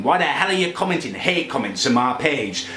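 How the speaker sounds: ordinary talk, nothing marked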